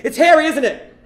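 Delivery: P=A; nervous blurt. Retold or imitated. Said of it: "and I just say".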